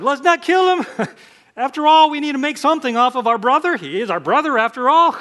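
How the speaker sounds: high, loud mock plotting voice, a laugh inside; scheming brother. Judah to his brothers, hammed-up sly scheming. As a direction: play Judah slyly talking his brothers into profit. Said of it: "Judah said".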